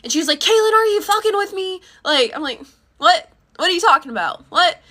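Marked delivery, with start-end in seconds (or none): 0.4-1.8 high, loud, accusing mom voice; mother bursting in, demanding answers; mom's angry outburst. Retold or imitated. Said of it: "banging on my door damn near". 3.0-4.8 high, loud, confused protest; startled denial. Retold or imitated. Startled reply to mom.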